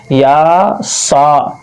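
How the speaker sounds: या सा very slow, both vowels held; long, steady aa on each syllable; properly stretched vowels. Shown as a demonstration. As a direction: draw out या and सा evenly. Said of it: "सही से समझिए".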